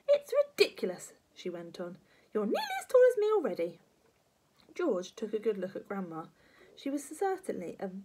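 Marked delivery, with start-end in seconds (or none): 0.1-1.1 very high, loud indignant granny; indignant pitched-up outburst; scolding grandma. Acted. 2.3-3.8 high, loud, fast granny complaint; quick, shrieky old nag; scolding grandma. Acted.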